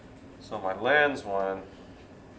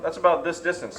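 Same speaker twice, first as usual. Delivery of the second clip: ordinary talk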